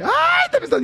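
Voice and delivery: sudden very high yelp; overwhelmed cry. Real, not acted. Host swamped by fast messages, high startled cry.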